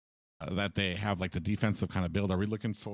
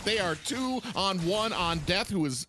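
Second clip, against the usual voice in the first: rising, excited commentary, higher and higher; live play-by-play; 10 semitones higher than usual. Acted. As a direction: call the fight live with building hype. Play-by-play of team fight.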